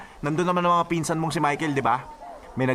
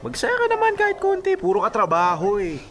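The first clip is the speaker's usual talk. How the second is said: pitched way up, coaxing and playful; teasing urge. Acted. Friend urging the workaholic to loosen up, playfully high. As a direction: egg him on with a grin.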